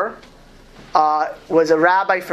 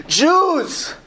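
desperate cry; 9 semitones higher than usual. Retold or imitated. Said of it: "I heard the cry".

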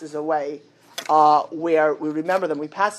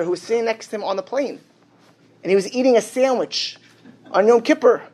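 ordinary delivery

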